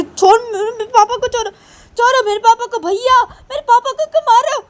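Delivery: put-on very high, loud little boy's voice; a small boy scolding his cousin; upset child. Siya's son yells at his cousin, high and loud.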